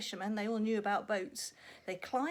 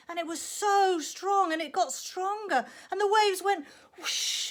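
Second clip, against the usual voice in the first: higher, louder, rising excitement; storm building; 8 semitones higher, 8 dB louder than usual. Acted. Storyteller whipping up the storm for children, high and loud. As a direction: crank up the storm drama.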